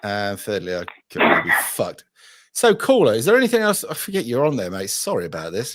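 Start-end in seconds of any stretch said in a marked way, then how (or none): 2.5-3.8 radio host. Acted. Host's bright radio address.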